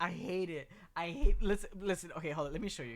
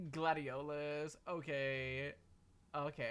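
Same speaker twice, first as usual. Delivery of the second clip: usual speaking voice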